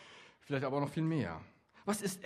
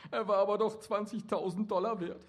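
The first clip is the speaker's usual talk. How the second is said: whimpering; 4 semitones higher, 4 dB quieter than usual. A narrator's reading. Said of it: "wimmerte der Mann".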